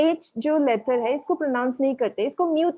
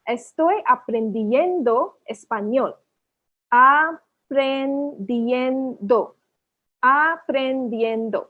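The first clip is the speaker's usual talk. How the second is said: aprendiendo drawn out slowly, syllable by syllable; slow pronunciation demo; much slower than usual. Shown as a demonstration. Teacher slowly models Estoy aprendiendo español for learners. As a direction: spell out aprendiendo slowly for the class. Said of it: "Four syllables".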